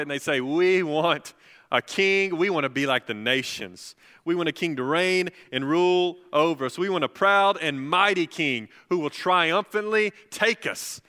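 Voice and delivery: high, loud and driving sermon voice; loud, high pulpit delivery; preaching at full force. Acted. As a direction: preach it out with rising fire.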